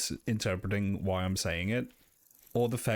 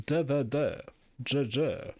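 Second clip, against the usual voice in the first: light mock comic voice, a touch higher; imitating Brian Butterfield; 4 semitones higher, slower than usual. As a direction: bumble out the syllables like a comic character. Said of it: "he goes".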